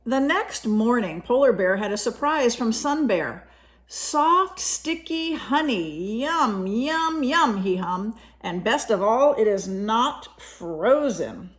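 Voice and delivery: lively storybook reading voice; reading to children. A narrator's reading. Parent reading a picture book to a child, lively storytelling. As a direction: read it brightly to a young listener.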